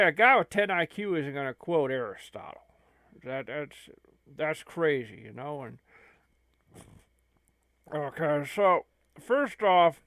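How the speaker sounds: usual speaking voice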